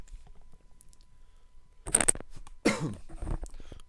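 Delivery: a dry, wet-sounding cough; real cough. Real, not acted. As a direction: cough for real, mid-sentence. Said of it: "just had to cough".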